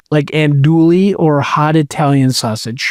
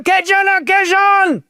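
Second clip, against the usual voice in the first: yelled in a Cajun accent; 14 semitones higher than usual. Shown as a demonstration. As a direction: yell "A Cajun occasion!" high in a Cajun accent. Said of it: "You have to yell it, like, in a Cajun accent".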